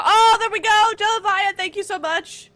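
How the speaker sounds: loud, high excited cheer. Real, not acted. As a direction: celebrate like the fight finally came. Caster celebrates a fight starting.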